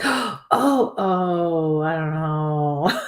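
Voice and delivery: long drawn-out, dithering groan; mock agonized indecision. Teasing him over his dinner dilemma, mock-agonized. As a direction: imitate someone torn over a choice, dragging it out. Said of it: "It was a true dilemma for him".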